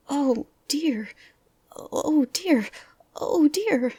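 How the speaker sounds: high worried little character voice; fretful Piglet. A narrator's reading. Piglet talking to himself near the heffalump trap, fretful. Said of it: "said Piglet to himself".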